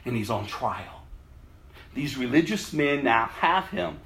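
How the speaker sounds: usual speaking voice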